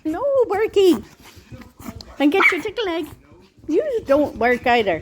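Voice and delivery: high, loud scolding of a dog; pet talk. Real, not acted. Telling the dogs off.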